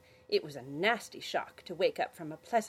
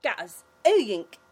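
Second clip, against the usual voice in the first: mouth full; 5 semitones higher, 10 dB louder, slower than usual. Acted. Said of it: "through a mouthful of marshmallow".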